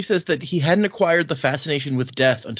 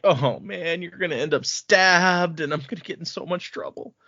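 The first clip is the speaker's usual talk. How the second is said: higher, fretful worried guard; mock panic; 5 semitones higher than usual. Guard panicking as Shallan heads to fights, higher and fretful. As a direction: panic about getting in trouble. Guard frets about being blamed.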